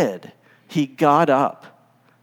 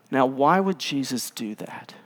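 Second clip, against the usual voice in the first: usual speaking voice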